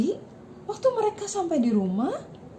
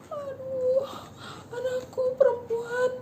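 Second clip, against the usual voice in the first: high, drawn-out storyteller's wail; menangis; 8 semitones higher, slower than usual. A narrator's reading. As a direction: act out a father crying for his daughter. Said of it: "Dia menangis ya. Dia bilang". (ratapan ayah bernada tinggi)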